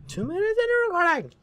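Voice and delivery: very high, loud screech; exasperated scream. Real, not acted.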